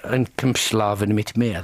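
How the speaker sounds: slow German phrase, low; deliberate, low attempt at German; German accent. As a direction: say it slowly in amateur German. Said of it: "How's your German accent?".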